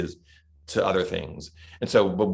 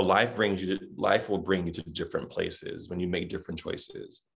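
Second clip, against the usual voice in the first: ordinary talk, nothing marked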